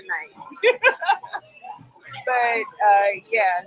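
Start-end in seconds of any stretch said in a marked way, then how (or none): 0.8-1.4 laugh. Real, not acted. Laughing at co-host's joke.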